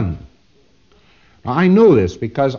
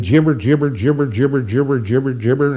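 lightly mimicked rhythmic babbling; imitated street jabberer. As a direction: babble on in an even, repeating rhythm. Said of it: "Fellow walks down the street saying".

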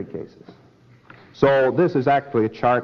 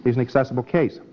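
ordinary talk

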